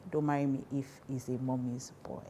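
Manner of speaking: normal talking voice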